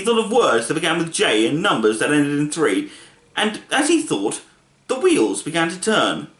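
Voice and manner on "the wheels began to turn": ordinary delivery, nothing marked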